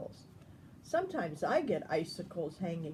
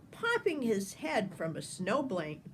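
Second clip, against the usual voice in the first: plain reading voice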